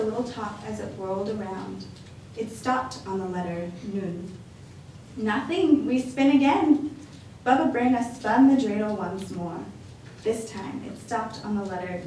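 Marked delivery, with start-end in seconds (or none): 5.2-6.9 put-on higher, louder kindly old woman; lightly voiced cheerful grandma welcoming a guest; a hint of a bright old lady; old grandmother voice. Baba Brana's voice.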